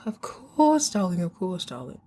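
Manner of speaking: put-on a touch of the mother's mid-Atlantic accent. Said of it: "you gotta watch that mid-Atlantic accent".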